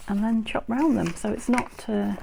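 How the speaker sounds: ordinary talk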